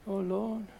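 soft, unsure mock prayer; timid mock prayer. Mocking a hesitant believer praying, soft and doubtful. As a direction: sigh it out, doubtful and small. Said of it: "You don't have to".

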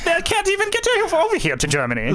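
very high, hammed-up German accent. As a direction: push the mock Hitler German accent into a squeak. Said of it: "that's a German accent".

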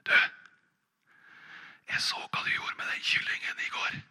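breathy secret whisper close to his ear; sly whispered blackmail. Acted. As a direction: lean in and whisper the threat. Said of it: "så kvisker han i øret".

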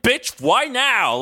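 slow drawn-out exasperated Beast. Retold or imitated. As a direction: drag it out, fed up.

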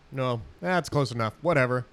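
ordinary talk

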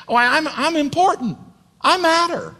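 high-pitched, hammed-up self-importance; self-important priest. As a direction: play a priest who's all about himself. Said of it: "who's all about him".

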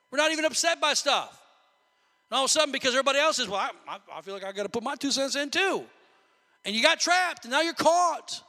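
loud, high preaching climax; rising revival preacher shout; preaching at full force. Acted. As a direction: build up and hammer it.

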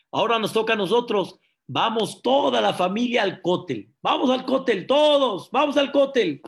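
high, loud, building call; rally everyone, rising to a shout; excited rallying. Acted. Father rounding up the family for the Kotel, excited rallying.

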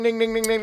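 imitated alarm bell.

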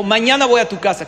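a tsar's loud order; commanding tsar. Acted. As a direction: announce it loudly as a demanding ruler. Tsar's demand in the story.

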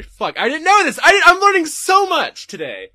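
loud, high burst of excitement; real excited amazement. Real, not acted. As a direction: blurt it out, thrilled by the discovery. Amazed at learning from chat.

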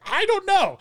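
high, loud imitation of a German woman; exclaimed in a mock German accent; thick German accent. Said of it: "in this very thick German accent".

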